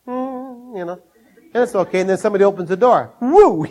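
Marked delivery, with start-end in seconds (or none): 3.2-3.7 higher, louder whoop; mock alarm. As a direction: react to the bee flying in. Bumblebee comes in.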